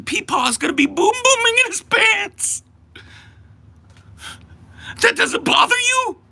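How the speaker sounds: shrieking high, near-crying rant; mock panic. Streamer working himself into mock panic over election, high crying wail. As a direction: wail it in hammed-up hysteria. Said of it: "I'm getting worked up".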